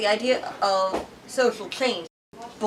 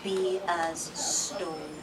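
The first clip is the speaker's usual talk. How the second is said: calm mother's counsel; 7 semitones lower, 9 dB quieter, much slower than usual. Acted.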